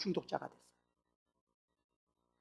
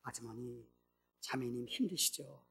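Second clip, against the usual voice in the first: much softer, slow, tender greeting; gentle pastor; 4 semitones lower, 14 dB quieter than usual. Acted. Pastor gently greets the bitter woman, soft and tender. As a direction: ease in softly as if not to startle her.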